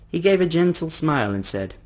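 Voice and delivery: plain reading voice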